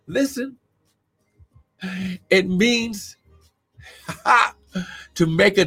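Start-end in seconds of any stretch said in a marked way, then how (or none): none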